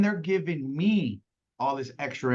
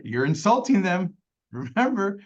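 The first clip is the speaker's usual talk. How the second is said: ordinary talk, nothing marked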